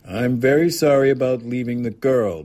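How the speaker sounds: troubled aunt's apology; anxious apology. A narrator's reading. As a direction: apologise earnestly, voice lifted. Aunt apologises, anxious for the girl.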